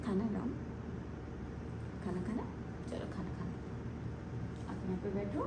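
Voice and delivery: whispered coax to the bird; soft coaxing. Real, not acted. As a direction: hush and lure it gently. Softly coaxing parrot to eat.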